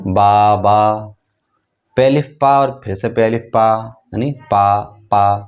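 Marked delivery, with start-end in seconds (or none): 0.1-1.2 slow blending. Shown as a demonstration. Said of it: "बे अलिफ बा और फिर से बे अलिफ बा".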